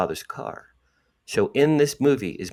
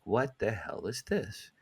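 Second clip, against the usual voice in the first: much softer, slow, stunned question; hushed disbelief; 10 dB quieter, slower than usual. Retold or imitated. Holding the placemat, he asks himself softly, stunned. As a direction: barely breathe the words.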